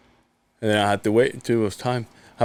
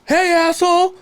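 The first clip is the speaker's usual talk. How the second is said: very high, loud mock holler; Howard; 5 dB louder than usual. Friend imitating Howard phoning to complain, high brash holler. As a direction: bark it out as a hammed-up truck driver. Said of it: "that's how Howard talks".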